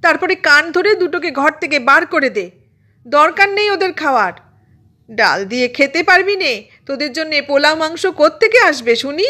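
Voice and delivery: high-pitched scolding tirade; fed-up mother yelling orders and reproaches; angry yelling. A narrator's reading. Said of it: "আরও জোরে চেঁচিয়ে চেঁচিয়ে বলল". (রাগে চেঁচিয়ে মায়ের বকাঝকা)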